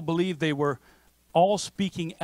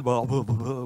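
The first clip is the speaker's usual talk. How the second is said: put-on drunk babble; 5 semitones lower than usual. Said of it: "They're drunk".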